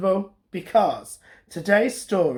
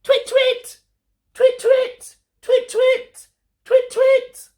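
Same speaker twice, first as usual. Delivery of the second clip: very high, louder owl twitter; 7 dB louder, slower than usual. Acted. Olive twitting again and again for her dream owl. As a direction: twit over and over like an owl. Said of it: "twitting over and over".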